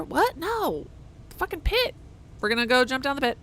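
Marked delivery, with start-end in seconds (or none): 1.3-1.9 miner voice. Acted. NPC voice again.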